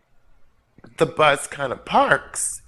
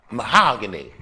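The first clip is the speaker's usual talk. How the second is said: bus driver's sassy brag; 4 semitones higher than usual. Retold or imitated. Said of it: "You know what she said".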